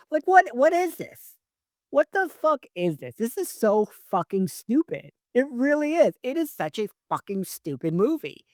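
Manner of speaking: high-pitched, incredulous rant; exasperated disbelief. Real, not acted. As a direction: let exasperation push the pitch way up. Ranting at the movie's ending.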